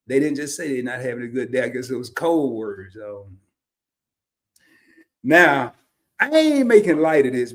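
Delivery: normal talking voice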